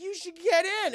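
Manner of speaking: put-on high, loud kid voice; coaxing kid. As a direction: coax him into the water.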